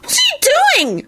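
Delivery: very high alarmed squeal. A narrator's reading. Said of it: "Solange squealed".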